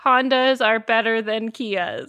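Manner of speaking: slow mock prophecy; solemn prophet voice. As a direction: intone it like a prophet. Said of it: "for the interpretation".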